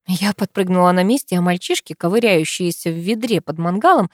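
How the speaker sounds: ordinary delivery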